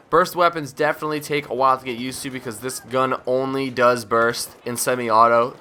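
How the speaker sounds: usual speaking voice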